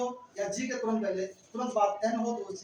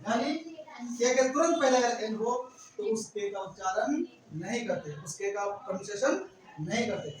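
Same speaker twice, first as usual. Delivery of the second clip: ordinary delivery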